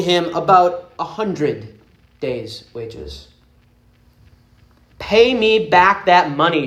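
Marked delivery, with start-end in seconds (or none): 5.0-6.7 louder, higher, demanding shout; a creditor threatening his friend. Acted. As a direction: bark the demand at the debtor. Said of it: "shouted the slave".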